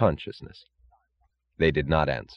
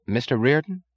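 timid, higher-pitched query; Holloway; 7 semitones higher than usual. A narrator's reading. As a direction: ask it tentatively, voice raised in pitch. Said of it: "said Holloway timidly".

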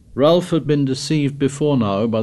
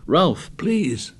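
higher, mildly anxious begging; apprehensive plea; 5 semitones higher than usual. A narrator's reading. Piggy begs Ralph to stop teasing, apprehensive. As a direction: plead with a touch of worry. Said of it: "clasped his hands in apprehension".

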